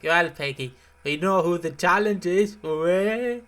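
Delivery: mock gloating. Imagined gloating over video views.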